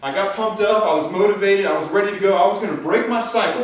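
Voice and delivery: rising fired-up energy; pumped up. Real, not acted. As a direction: coach getting the room hyped. Pumped-up retelling of his swing.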